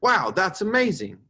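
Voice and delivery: high, loud, drawn-out amazement; amazed exclamation. Shown as a demonstration. Teacher demonstrates exclaiming for fourth graders, amazed exclamation. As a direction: exclaim it slowly, really wowed. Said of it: "they're exclaiming something rather than just saying it".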